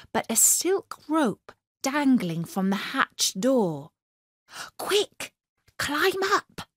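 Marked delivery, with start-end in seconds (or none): 4.8-6.7 high, hurried princess urging; urgent whisper. A narrator's reading. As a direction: hurry him up urgently. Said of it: "whispered the voice".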